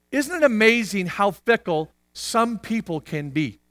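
usual speaking voice

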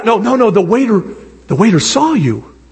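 normal talking voice

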